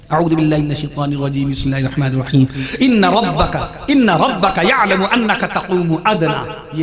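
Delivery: formal recitation, low opening then emphatic; Quran recitation. Real, not acted.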